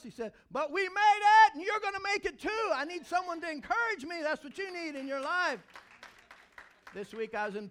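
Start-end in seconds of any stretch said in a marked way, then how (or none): 0.5-5.6 high, loud rallying cry; loud, high hype from the pulpit; preacher's shout. Retold or imitated. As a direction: shout encouragement to the whole room. Mentor's encouragement builds to shout.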